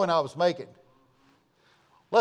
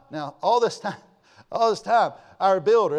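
usual speaking voice

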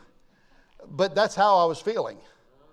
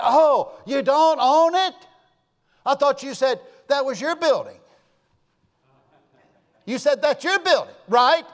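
playing dumb; 7 semitones higher, 5 dB louder than usual. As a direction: act innocently amazed to needle him. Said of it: "So I just kind of played dumb".

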